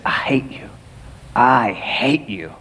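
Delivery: slow, whispered hatred; bitter whisper. Retold or imitated. Mel Gibson's character whispering his hatred at God. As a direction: breathe the words out low and slow. Retelling film's bitter prayer.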